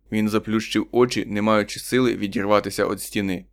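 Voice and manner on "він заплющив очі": ordinary delivery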